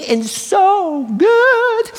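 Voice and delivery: blissful. Acted. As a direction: swoon over the taste. Ecstatic about clover.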